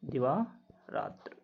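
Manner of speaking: दिवारात्र stretched out very slowly and softly; slow model pronunciation. Shown as a demonstration. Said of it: "मात्रा नहीं लगती".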